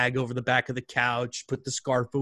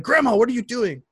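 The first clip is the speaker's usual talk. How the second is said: urgent call to grandma; 10 semitones higher, 7 dB louder than usual. Retold or imitated. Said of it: "I'm like".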